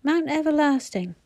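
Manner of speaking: high, winded complaint; lightly acted gasp. A narrator's reading. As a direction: push it out short of breath. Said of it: "gasped Phyllis".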